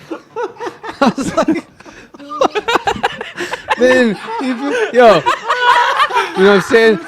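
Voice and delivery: high, slow, breaking into laughter; laughing. Real, not acted. Telling the hamper shirt story, laughing through it.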